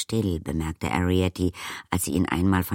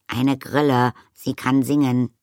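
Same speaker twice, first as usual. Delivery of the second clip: high, louder, impatient insisting; annoyed, raised-voice explanation; indignant; 7 semitones higher, 5 dB louder than usual. A narrator's reading. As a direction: insist on it in exasperation. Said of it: "erklärte Arrietty unwillig".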